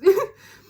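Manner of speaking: one loud, high burst of laughter. Real, not acted. Laughing loudly at the man who pestered her. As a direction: let a big laugh burst out.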